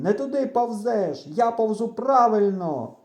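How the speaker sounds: high, insistent quarrelling yell; shouted retort. A narrator's reading. Friend arguing across the corn, shouted retort. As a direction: shout back that you are right. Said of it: "кричить він".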